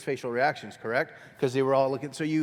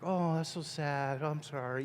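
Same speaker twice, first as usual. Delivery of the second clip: mock sympathy; 9 dB quieter than usual. Shown as a demonstration. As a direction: sound sorry for them. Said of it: "you're like".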